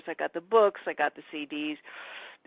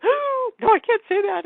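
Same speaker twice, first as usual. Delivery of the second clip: put-on much higher, louder gasping girl voice; horrified girl; 7 dB louder than usual. The girl taking back her wish, high and horrified. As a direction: catch yourself in horror.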